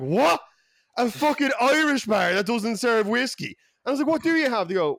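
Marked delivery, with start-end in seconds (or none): 0.0-3.6 high, loud Irish outburst; outraged disbelief. Retold or imitated. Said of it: "I fucking lost it". 4.1-4.7 very high, loud re-enacted outburst; exasperated demand. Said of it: "I fucking lost it".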